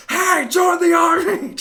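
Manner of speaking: high, loud recruiter's shout; blaring, over-the-top army recruiting yell; really loud. Acted. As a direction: yell it out the window at passers-by. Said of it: "Just, like, really loud".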